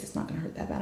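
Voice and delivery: hushed whispered reassurance. Retold or imitated. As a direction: whisper it like a coaxing boyfriend.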